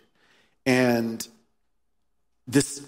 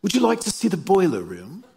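higher, amused mock British accent; 6 semitones higher than usual. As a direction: put on a playful British accent, half laughing. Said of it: "in a British accent, I'm sure".

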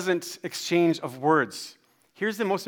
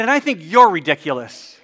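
loud, raised-pitch mock outrage; indignant comeback; 4 semitones higher, 12 dB louder than usual. Dad quarrelling with the construction man, indignant. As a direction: throw the insult back loudly. Retold insult in sidewalk quarrel.

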